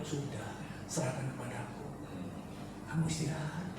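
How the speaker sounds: very soft, reassuring voice; tender comforting. Retold or imitated. God telling the weary one he carries to rest, tenderly.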